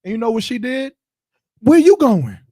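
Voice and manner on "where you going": higher, louder demanding woman; mock angry woman. Other woman confronting the man, a mock angry demand. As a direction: snap at him like the jealous other woman.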